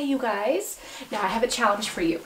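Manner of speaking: normal talking voice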